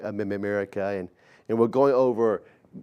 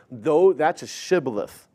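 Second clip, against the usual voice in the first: ordinary talk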